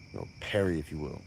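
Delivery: usual speaking voice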